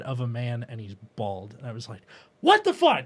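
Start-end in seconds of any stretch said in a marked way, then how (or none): 2.4-3.0 high, loud shocked outburst; sudden loud fright at a hulking silhouette; startled yell. Retold or imitated. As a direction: jump at a stranger in the doorway.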